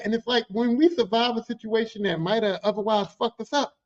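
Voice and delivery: ordinary talk, nothing marked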